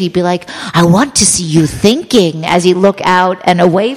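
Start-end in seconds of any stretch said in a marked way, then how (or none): 0.7-2.5 mock-grand projected command; theatrical director. Director looking away from his actress, hammed-up grand. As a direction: imitate a director playing to the gallery. Imitating director addressing nobody.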